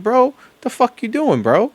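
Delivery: high, incredulous "bro, what are you doing"; exasperated disbelief. Retold or imitated. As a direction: throw up your hands at them, voice jumping up. Exasperated aside at flag-flyers.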